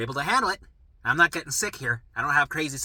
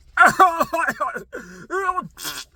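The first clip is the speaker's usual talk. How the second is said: burst of laughter; 14 semitones higher, 10 dB louder than usual. Retold or imitated. Mocking the euphoric recovery.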